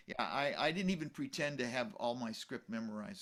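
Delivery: ordinary delivery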